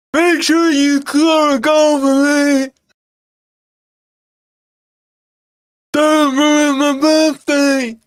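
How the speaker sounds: high, slow shouted impression of a fan in the crowd; impression of Ant. Streamer imitating his mate Ant yelling at Nuno from the crowd, high slow shout. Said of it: "Is that a good impression of Ant?".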